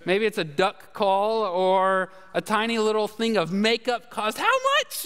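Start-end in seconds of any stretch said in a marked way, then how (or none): 4.4-5.0 high, mock startled yelp; shocked. Preacher playing a shocked buyer at a price, mock startled. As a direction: jump up in pretend sticker shock.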